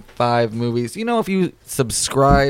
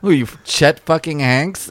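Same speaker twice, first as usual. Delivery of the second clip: ordinary delivery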